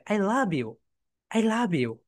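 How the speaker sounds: "love" given a melodic push; stress on love. Shown as a demonstration. Teacher shows how British and Americans talk, stress on "love". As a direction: lean on "love", pushed up then falling away on "you". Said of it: "তারা এইভাবে কথা বলে".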